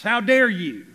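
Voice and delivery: loud, offended outburst; indignant retort. Acted. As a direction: throw it back as an angry rebuff. Acted hostile reply to correction.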